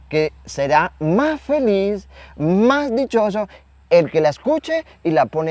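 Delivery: high, slow proclamation; emphatic proclamation. Retold or imitated. The Lord crying out a promise to us, emphatic. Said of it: "nos grita a nosotros hoy".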